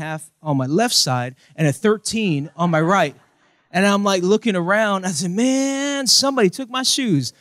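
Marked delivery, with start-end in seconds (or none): 5.3-7.3 retold dismay. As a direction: whine it in a raised voice, acting out the discovery. Retold dismay at missing shoes.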